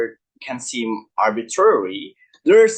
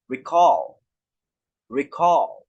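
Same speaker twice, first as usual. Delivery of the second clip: "recall" with a long, weighted second syllable; stress on second syllable; slower than usual. Shown as a demonstration. Teacher modelling two-syllable verbs, "recall" stressed on its second syllable. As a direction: lean on the end of "recall". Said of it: "stress is typically on the second syllable".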